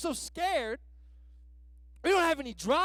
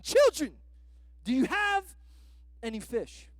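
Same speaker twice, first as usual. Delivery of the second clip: loud mock call; 4 dB louder than usual. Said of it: "stranger calls to the boat".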